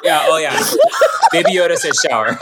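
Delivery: laughing through the words; breaking up with laughter; laughing hard. Real, not acted. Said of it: "I'm crying laughing".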